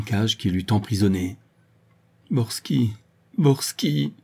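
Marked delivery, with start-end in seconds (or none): 2.3-4.1 gémir le nom, qui monte; gémissant. Acted. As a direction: plainte désespérée. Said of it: "gémissait-elle". (Véronique gémit, hantée par son mari)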